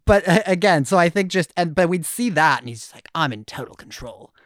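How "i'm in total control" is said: low, soft mock-British boast; badly faked British accent, pitched down and quieter. Co-host imitating a smug contestant's confessional, bad British accent. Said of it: "gonna be a bad British accent".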